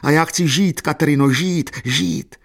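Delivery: high, loud anguished outcry; desperate cry. A narrator's reading. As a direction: plead desperately for life, pitch climbing. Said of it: "zvolal zoufale". (zoufalý, vysoký křik)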